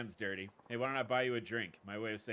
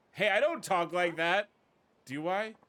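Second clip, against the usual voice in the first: louder, higher indignant protest; offended protest; 8 semitones higher, 11 dB louder, slower than usual. Acted. Thug protesting the woman's mockery, indignant and loud. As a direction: sputter in wounded disbelief.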